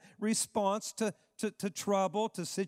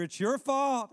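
blaming; 7 semitones higher, 4 dB louder than usual. Retold or imitated. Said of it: "they were blaming their leader".

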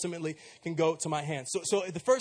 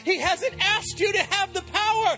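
loud, higher climactic preaching; full-force preaching; 9 semitones higher, 4 dB louder than usual. Acted. Pastor rallying the church, loud and higher. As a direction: build the congregation up with fire.

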